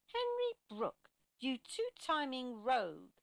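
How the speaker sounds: high, drawn-out accusing shout; indignant shout. A narrator's reading. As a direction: call out the insult slowly and loudly.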